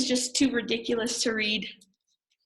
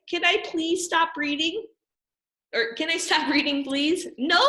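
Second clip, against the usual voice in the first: pleading to stop; 7 semitones higher than usual. Acted. Reader begging to stop.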